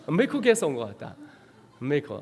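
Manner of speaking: normal talking voice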